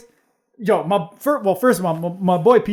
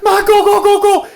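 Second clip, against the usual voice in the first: higher, louder laughing go go; mock frantic yell; 13 semitones higher, 8 dB louder than usual. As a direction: urge mom to floor it while laughing. Yelling at mom to drive.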